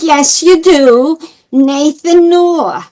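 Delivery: mimicking an emphatic New York man; heavy New York accent. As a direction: stretch it out in thick New York. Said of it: "very heavy New York accent".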